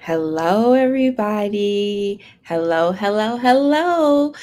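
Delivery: drawn-out, rising singsong greeting; calling out hello. Real, not acted. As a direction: hail the online crowd with stretched hellos. Host greets arriving livestream audience.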